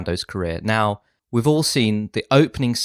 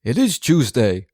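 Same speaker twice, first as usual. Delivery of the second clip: Don Corleone impression; 3 dB louder, slower than usual. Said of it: "Have you heard my impression?".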